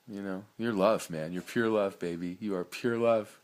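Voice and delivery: ordinary talk, nothing marked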